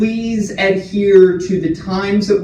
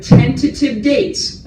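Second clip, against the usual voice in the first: "tentative" punched high; stress on tentative; 6 semitones higher, slower than usual. Real, not acted. Staff warning residents about vaccine dates, stressing "tentative". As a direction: lean on the word "tentative", pitched up. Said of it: "I want to stress that word again".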